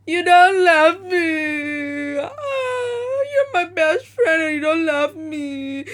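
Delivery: imitating mom crying during an argument, slow and high; mock crying mom. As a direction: wail it like a guilt-tripping mother. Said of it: "she's like".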